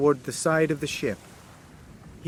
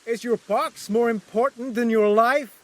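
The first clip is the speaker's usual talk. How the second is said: high, pleading shout; shouting question; 8 semitones higher than usual. Acted. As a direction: demand an answer, pitched up. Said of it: "I shouted".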